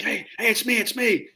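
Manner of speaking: calm the trigger-happy squad fast; anxious hey. Retold or imitated.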